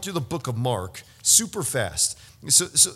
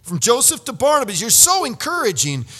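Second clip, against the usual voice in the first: lifted, louder, eager praise; enthusiastic praise; 7 semitones higher, 3 dB louder than usual. Retold or imitated. Preacher voicing Jesus praising Barnabas, eager. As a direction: gush over him with delight.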